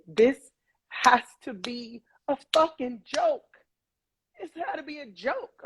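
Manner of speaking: reliving shock, voice lifted high; anguished disbelief. Retold or imitated. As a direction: drag the words out in stunned protest. Retold disbelief on finding father down.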